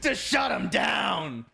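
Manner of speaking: shouting the ace call; excited shout. Real, not acted. Caster calls the ace.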